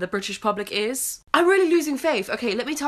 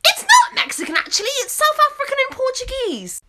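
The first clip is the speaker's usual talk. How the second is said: very high, louder know-it-all tone; snooty correction; 6 dB louder than usual. Acted. Waitress correcting ignorant customer, high snooty tone. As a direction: correct him with a superior sniff.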